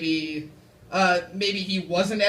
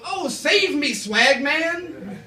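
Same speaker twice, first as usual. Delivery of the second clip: mock plea; 8 semitones higher, 4 dB louder, much slower than usual. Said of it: "And I'm gonna go".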